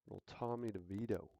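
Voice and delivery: normal talking voice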